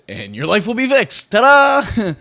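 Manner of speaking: a grinning guru selling the fix; mock guru. Mocking a self-help guru's grand promise, shouted ta-da. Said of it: "a fucking guru".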